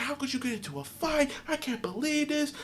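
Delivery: high, soft mock mom voice; imitating an upset mom, pitched high; mom scolding. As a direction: scold like an exasperated mother. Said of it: "She's like".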